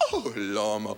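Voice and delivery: "Oh, llama" said way low, like the made-up word; punchline callback. Retold or imitated. The lady answering what broca means: deep callback. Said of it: "And she goes".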